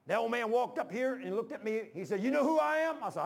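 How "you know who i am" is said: high, loud, confronting question; loud challenge. Retold or imitated. Old man walks up to confront the preacher, loud challenge.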